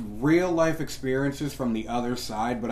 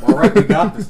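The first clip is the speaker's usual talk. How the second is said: laughing; 8 semitones higher, 12 dB louder than usual. Real, not acted. Laughing loud exclamation.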